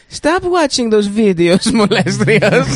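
high slow put-on scolding voice; mock character voice. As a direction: scold slowly in a high silly voice.

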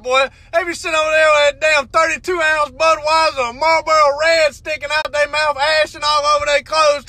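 usual speaking voice

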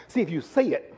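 ordinary talk, nothing marked

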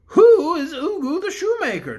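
the Bear King addresses the cook; kindly Bear King. Acted. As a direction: speak as a gentle storybook king. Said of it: "demanded the king".

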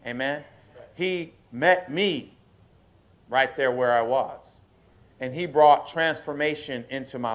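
preaching at full force to the church; rising preaching. Acted. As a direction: drive it home to the congregation.

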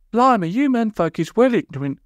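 indignant, high character voice; high-pitched huffy telling-off; Polly. A narrator's reading. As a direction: scold in a high, put-on voice. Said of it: "said Polly indignantly".

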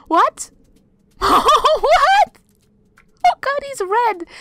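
high startled shriek; shocked. Real, not acted. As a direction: squeal it in disbelief. Sudden red creature appears.

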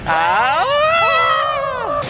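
high, drawn-out "aah"; sustained high yell; long mock scream. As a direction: hold a high playful scream. Harry Potter spell play.

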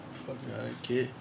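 ordinary delivery

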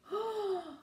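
sharp high mock gasp. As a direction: gasp in pretend shock. Narrator shocked at theft.

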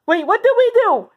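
high-pitched protest of innocence; alarmed protest. Pretend. Summoned bully to teacher, alarmed protest. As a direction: play a kid caught out.

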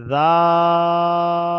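জাল held very long and loud, the vowel stretched; drawn-out letter name. Shown as a demonstration. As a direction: stretch জাল out slowly like a recitation. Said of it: "তারপরে".

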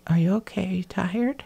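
softly imitating a nosy question; retold mock concern. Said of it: "he's like".

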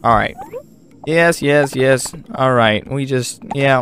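ordinary talk

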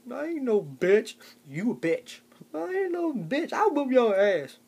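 high, slow, squeaky tough-guy comeback; squeaky defiant threat. Acted. Short man threatening big Tony, high squeaky defiance. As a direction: puff up like a tiny man picking a fight.

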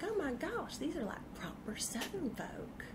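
put-on a touch of Southern accent, fading into a soft whisper; admiring Louisiana locals in a mock Southern accent. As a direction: gush in a hammed-up Southern accent. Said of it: "I should bring out the Texas accent".

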